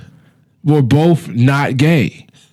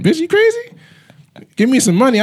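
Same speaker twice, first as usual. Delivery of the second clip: incredulous; 13 semitones higher than usual. Retold or imitated. Mock rant at imaginary girlfriend.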